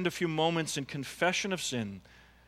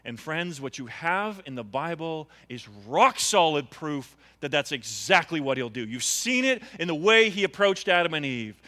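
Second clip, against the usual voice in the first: ordinary delivery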